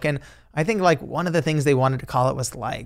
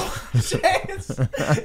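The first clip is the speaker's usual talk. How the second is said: laughter; 4 dB quieter than usual. Real, not acted. Laughing after impression.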